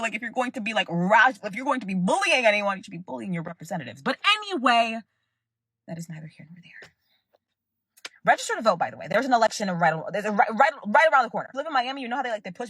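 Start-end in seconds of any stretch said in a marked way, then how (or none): 5.9-6.9 whispered aside. Real, not acted. Whispered aside after but anyway.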